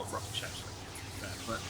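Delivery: breathy half-whisper under his breath; quick whispered afterthought; whispered aside. Real, not acted. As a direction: drop to a whisper for a grudging concession. Grudging aside while correcting himself.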